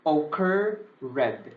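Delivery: occur red as two separate words, oh-KUR... RED; spelling memory pronunciation. Shown as a demonstration. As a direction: pause between occur and red. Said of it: "I usually say".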